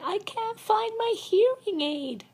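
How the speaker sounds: high, loud, slow mock holler; imitating Elsie. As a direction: imitate a hard-of-hearing elder calling out. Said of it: "She said".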